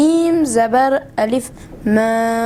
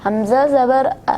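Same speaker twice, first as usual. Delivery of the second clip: quick, slurred حمزہ زبر ا; unclear; faster than usual. Real, not acted. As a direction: rush حمزہ زبر ا together indistinctly. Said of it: "واضح پڑھیں".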